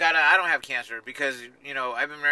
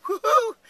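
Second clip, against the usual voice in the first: high, loud "woo-hoo"; playful high-pitched cheer; excited whoop; 7 dB louder than usual. Real, not acted. Excited greeting whoop on camera.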